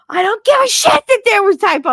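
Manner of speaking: imitating a high, loud outburst; angry yell. Principal yelling at teacher over email typos, angry yell. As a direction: explode at a petty correction. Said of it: "did not just yell at me".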